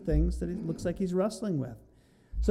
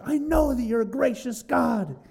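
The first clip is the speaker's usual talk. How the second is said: higher, bitter accusing quote; angry Jonah; 7 semitones higher, 4 dB louder than usual. Retold or imitated. Jonah complaining to God, bitterly angry. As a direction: spit the praise out like a grievance. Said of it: "he's, like, so angry when he says".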